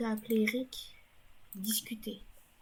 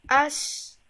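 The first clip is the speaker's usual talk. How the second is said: ordinary talk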